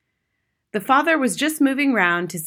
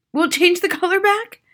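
high, loud anxious question; anxiously; 12 semitones higher, 6 dB louder than usual. A narrator's reading. Father hopes the color returns, anxiously. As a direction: ask it hopefully, voice pitched up with worry. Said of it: "the father said anxiously".

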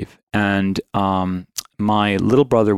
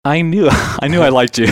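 higher, louder, laughing as you speak; pleased and laughing, lifted pitch; real laughing delight; 6 semitones higher, 4 dB louder than usual. Real, not acted. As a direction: break into a real laugh mid-line. Delighted at shared church background, laughs.

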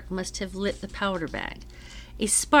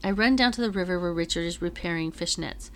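plain reading voice

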